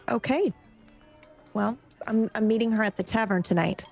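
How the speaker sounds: normal talking voice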